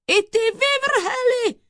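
very high, loud exclamation; shrieking indignation from the judge; outraged outburst. A narrator's reading. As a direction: burst out at the wretch. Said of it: "spustil doktor Korpus".

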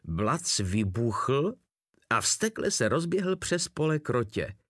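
plain reading voice

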